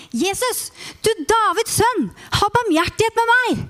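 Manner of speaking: high pleading cry for mercy. Acted. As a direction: call out desperately, pitched high. Blind man crying out to Jesus.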